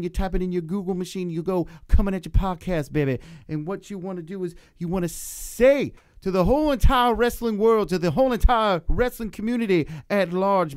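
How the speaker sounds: loud, hyped wrestler promo voice; wrestling promo voice. Pretend. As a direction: yell it like a pro wrestling promo. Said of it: "give us a little something in wrestler voice".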